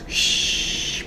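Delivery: soft, whispered shush; gentle hush. A narrator's reading. Mother shushing her crying baby, soft hush.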